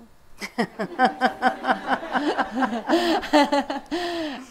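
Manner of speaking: big high burst of laughter; hearty real laugh; loud laugh. Real, not acted. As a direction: laugh out loud at the shared joke. Siblings who argue joke.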